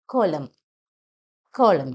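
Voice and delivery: കോളം as "KOL-um", clear O, no final N; O sound. Shown as a demonstration. Teacher models the word, O sound. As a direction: lead with a firm O in കോളം. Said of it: "ഓ sound തന്നെയാണ്".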